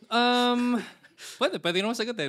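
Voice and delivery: high, loud laughing words. Real, not acted. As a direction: talk through a laugh. Host laughs, accepts the question.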